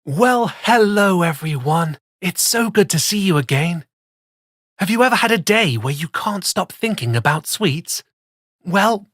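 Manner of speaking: ordinary delivery, nothing marked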